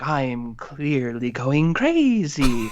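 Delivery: slow, hammed-up declaration of madness; mock hype. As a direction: play the madness big and obvious, slowly. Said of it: "kind of hype".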